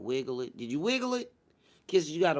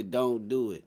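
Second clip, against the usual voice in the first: ordinary talk, nothing marked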